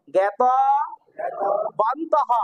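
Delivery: জ্ঞাত- then a long pause then বান্তঃ; split into syllables. Shown as a demonstration. Teacher breaking the word into parts for students. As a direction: break জ্ঞাতবান্তঃ into two clear parts with a gap. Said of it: "syllable split for students".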